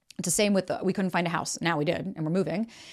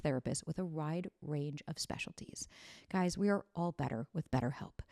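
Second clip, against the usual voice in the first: softer, measured podcast ad read; sponsor ad read; 8 dB quieter than usual. Acted. Host endorses a therapy sponsor to listeners, measured ad read. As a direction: read the sponsor copy softly and evenly.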